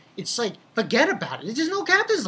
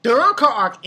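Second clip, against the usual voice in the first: usual speaking voice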